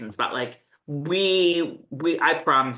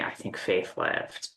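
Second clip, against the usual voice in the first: soft breathy whisper, slow; whispered reveal; 9 semitones lower, 7 dB quieter, slower than usual. Retold or imitated. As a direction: whisper it like a dark secret. Dramatic reveal in recap.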